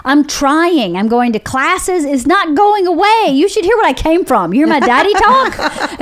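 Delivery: high-pitched, comically exasperated pleading; exasperated protest. Acted. Aspiring actress defending her Southern accent to critics, exasperated. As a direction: protest in a mock-frustrated high voice. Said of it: "And I kept going".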